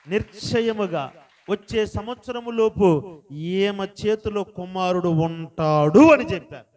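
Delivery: loud, slow, ringing preacher's voice; preaching at full force. Acted. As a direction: drive every word home to the congregation. Pastor preaches his testimony loudly.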